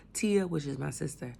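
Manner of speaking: ordinary talk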